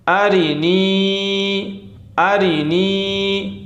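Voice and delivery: अरी नी with the ई of नी drawn out very long; stretched नी. Shown as a demonstration. Teacher drawing out the long vowel: अरी नी with stretched नी. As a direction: hold the ई in नी slowly. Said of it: "वो या मद्दा वाला है".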